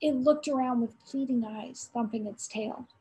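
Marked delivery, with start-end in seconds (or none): none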